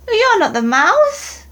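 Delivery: put-on high eager child creature; Gruffalo's child. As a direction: squeak it out like a curious young monster. Child questions the snake.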